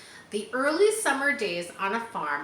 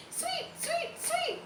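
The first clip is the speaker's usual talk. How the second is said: very high, soft tweeting sweet, sweet; high, soft sparrow song; soft birdcall; 6 dB quieter, slower than usual. A narrator's reading. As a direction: peep it like a tiny sparrow.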